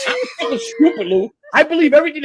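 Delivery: real exasperated shout at his own mistake; frustrated outburst. Real, not acted. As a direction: yell it at yourself in frustration. Frustrated self-blame to Lou.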